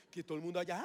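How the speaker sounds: ordinary delivery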